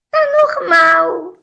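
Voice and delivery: drawn-out, unbothered mock answer; retold absent-minded actress. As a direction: lightly imitate an airy, clueless woman. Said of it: "tava sempre no ar".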